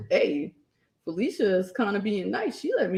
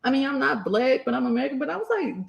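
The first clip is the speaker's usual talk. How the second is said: usual speaking voice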